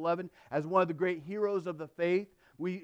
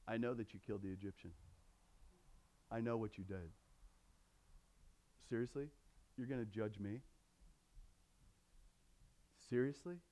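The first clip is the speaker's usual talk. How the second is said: sarcastic taunt; 7 semitones lower, 16 dB quieter, faster than usual. Retold or imitated. Slave mocks Moses, sarcastic pushback.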